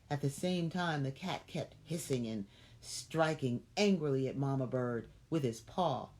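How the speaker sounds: plain reading voice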